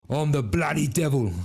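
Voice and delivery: hammed-up Cockney devil; English accent. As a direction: put on a broad English villain accent. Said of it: "our evil folk have English accents".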